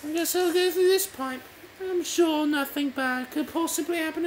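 high-pitched game heroine, chatty; lively high character voice; Faith voice. Acted.

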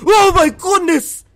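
high, loud, drawn-out shriek; frightened scream. Real, not acted. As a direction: scream out at the scare. Jump scare in the game.

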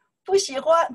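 high, loud 不喜欢; annoyed brush-off. Shown as a demonstration. Teacher demonstrating to students, loud annoyed 不喜欢. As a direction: fling out 不喜欢 at a pest. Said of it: "You can say".

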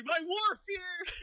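high, slow fake crying; drawn-out mock weeping; mock sobbing. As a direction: wail the words like a hammed-up sob. Mock crying, echoing the line.

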